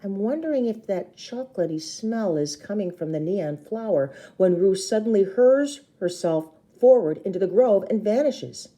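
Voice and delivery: plain reading voice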